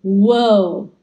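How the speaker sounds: loud, drawn-out wow of delight; amazed reaction. Retold or imitated. Diner lighting up the sweet-maker with their reaction, amazed. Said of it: "if our expressions would be".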